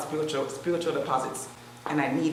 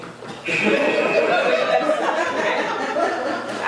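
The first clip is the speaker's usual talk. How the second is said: high, loud burst of laughter; 9 semitones higher, 7 dB louder than usual. Real, not acted. Big laugh mid workout.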